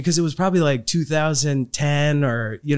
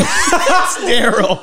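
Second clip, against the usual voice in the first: laughing echo; 8 semitones higher, 5 dB louder, much slower than usual. Retold or imitated. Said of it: "echo, laughing".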